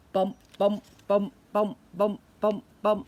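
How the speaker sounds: punchy bump bump beat; ball bouncing. Retold or imitated. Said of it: "dribbling his basketball".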